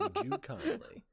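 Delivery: high, laughing mock boss voice; sassy boss. Coworker mocking the boss's sassy request, laughing high. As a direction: squeak it out sassily, laughing. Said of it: "sassy thing".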